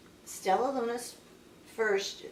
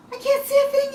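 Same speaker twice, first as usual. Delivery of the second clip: very high, loud bird shriek; panicked yell; 10 dB louder, faster than usual. A narrator's reading. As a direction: yell it out in high-pitched alarm. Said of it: "yelled Pip".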